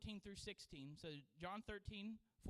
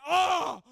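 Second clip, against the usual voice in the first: loud high cry of pain; a yell of hurt; 9 semitones higher, 21 dB louder than usual. Retold or imitated. Pastor on the ground, loud cry of pain.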